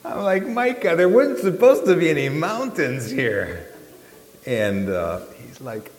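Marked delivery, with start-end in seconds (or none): 0.5-3.7 loud, high-pitched protest; retold outraged outburst, pitched up; exasperated complaint. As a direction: wail the complaint at the trip leader. Retold complaint to trip leader.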